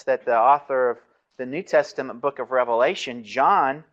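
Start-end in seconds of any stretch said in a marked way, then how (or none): none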